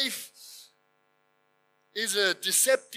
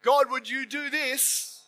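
slow, higher performed prayer; showy pious prayer; 4 semitones higher, slower than usual. Pretend. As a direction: pray as if for an audience. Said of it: "So I can pray a prayer".

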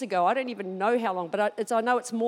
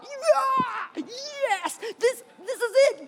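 much higher, gleeful yeah; inner excitement; 11 semitones higher, much slower than usual. Retold or imitated. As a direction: squeal inside with joy. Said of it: "On the inside, I'm like".

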